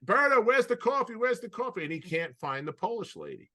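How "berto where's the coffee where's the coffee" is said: high, loud mock call for coffee; calling out across the house in a raised voice; imitating the coach yelling for coffee. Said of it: "he says".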